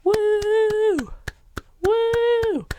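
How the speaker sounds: high-pitched "woo" whoops; mock audience cheer. Host imitating the clapping audience, high mock cheer. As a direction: whoop like an over-eager studio crowd. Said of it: "And everyone claps".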